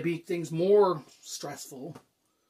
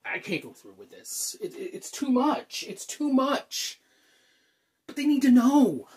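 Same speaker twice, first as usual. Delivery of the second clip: stammering, pitch climbing high; overwhelmed, voice jumping up; panicking; 7 semitones higher, 3 dB louder than usual. Acted. Panics about coming out to parents.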